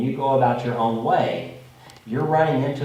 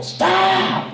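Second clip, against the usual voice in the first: a more forceful, louder yell; a harder, more urgent shout of stop; louder retold scream; 7 semitones higher, 5 dB louder than usual. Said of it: "I scream louder".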